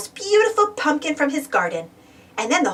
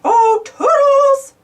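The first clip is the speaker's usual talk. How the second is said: long, high, loud call; 11 semitones higher, 6 dB louder, much slower than usual. Acted. Mickey calling for Toodles, long high loud call. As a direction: sing out the name slowly. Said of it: "said Mickey".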